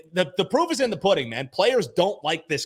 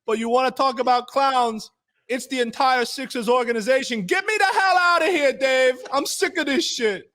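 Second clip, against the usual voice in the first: higher, louder put-on player voice; Joel Embiid impression; 9 semitones higher, 3 dB louder than usual. Podcast host voicing Joel Embiid, fed up and pleading. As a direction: voice a fed-up star begging to leave. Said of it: "Thanks, Joel".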